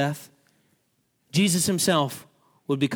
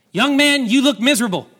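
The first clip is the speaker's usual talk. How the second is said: calling out; 9 semitones higher, 9 dB louder than usual. Retold or imitated. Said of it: "And he said".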